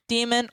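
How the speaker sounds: "Demon" as DEE-men, long ee; second name variant. Shown as a demonstration. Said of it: "it's either Demon".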